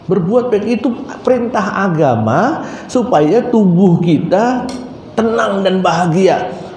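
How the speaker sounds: usual speaking voice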